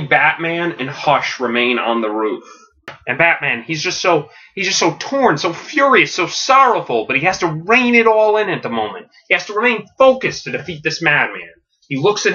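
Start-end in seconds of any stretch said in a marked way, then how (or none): none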